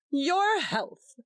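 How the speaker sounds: bright jovial teasing. A narrator's reading. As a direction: toss it off cheerfully. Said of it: "said Tompkins jovially".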